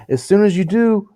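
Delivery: very high silly impression. Imitating a celebrity posting advice, silly high voice. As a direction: pitch it way up in mock earnest. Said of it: "he does that stupid fucking voice".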